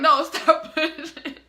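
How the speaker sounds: laughing, emphatic imitation; urgent retold command. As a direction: mimic staff insisting on a halt. Staff urgently halting her, retold.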